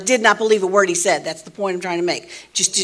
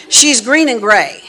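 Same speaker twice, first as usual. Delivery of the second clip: normal talking voice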